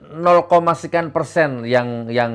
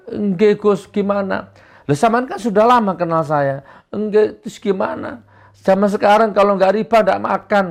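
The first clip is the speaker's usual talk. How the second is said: mock follower; 8 semitones higher than usual. Dialog jamaah.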